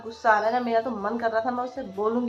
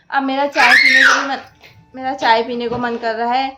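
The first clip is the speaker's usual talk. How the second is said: loud, half-laughing exasperated talk; mother complaining loudly that her daughter won't let her talk; loud over noise; 9 dB louder than usual. Real, not acted. Talking over child's screaming.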